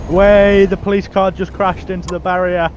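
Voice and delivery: high, loud "way" easing into amused commentary; gleeful cheer. Real, not acted. Driver tells convoy the police car crashed, gleeful cheer.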